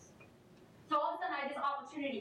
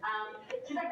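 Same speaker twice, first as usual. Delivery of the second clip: ordinary delivery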